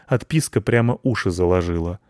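ordinary delivery

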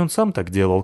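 ordinary delivery, nothing marked

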